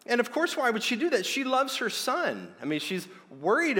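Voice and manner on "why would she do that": usual speaking voice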